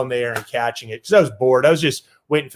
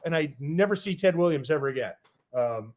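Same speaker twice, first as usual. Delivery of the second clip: ordinary delivery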